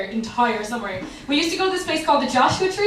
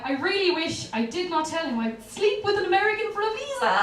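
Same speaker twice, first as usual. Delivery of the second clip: normal talking voice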